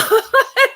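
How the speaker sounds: high, loud laugh; burst of laughter. Real, not acted. Amused at his remark about Obama, loud laughter.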